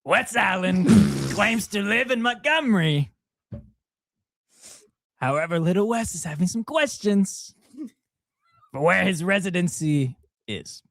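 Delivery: mock news anchor voice; put-on newscaster voice. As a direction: play a hammed-up local reporter. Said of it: "I'll explain in this accent".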